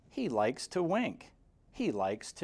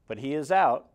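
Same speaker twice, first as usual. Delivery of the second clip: ordinary delivery, nothing marked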